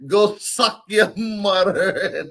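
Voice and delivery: laughing mock Jamaican comeback, slow; slow, laughing Jamaican-accented comeback; Jamaican accent mimic, laughing. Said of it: "That's their favorite comeback".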